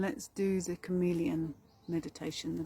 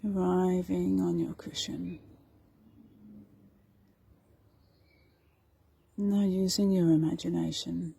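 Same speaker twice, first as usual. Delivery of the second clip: guided meditation; 7 dB louder than usual. Acted. Meditation teacher guiding listeners through meadow and forest, guided meditation. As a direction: lead them unhurriedly through the imagery.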